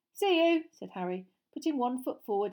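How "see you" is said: cheerful goodbye. Acted. Cheery farewell.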